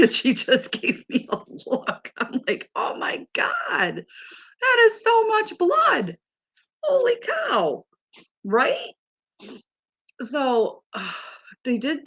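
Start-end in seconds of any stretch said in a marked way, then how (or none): 2.8-7.9 high, slow, drawn-out disbelief; hammed-up high-pitched amazement; acted shock. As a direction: replay your shock, pitched way up and stretched out. Retells shock at blood draw.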